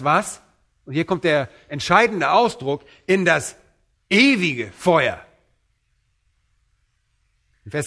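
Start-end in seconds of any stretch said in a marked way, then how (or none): 3.1-5.2 EWIGE hit hard and drawn out; slow down and punch EWIGE with weight; emphatic stress. Real, not acted. Preacher highlights the decisive expression.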